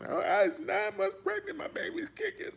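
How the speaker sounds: high, whiny hammed-up wail; mock crying woman. Mocking the pregnant politician, high whiny wail. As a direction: squeal it like a fake sob story. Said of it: "all that crying".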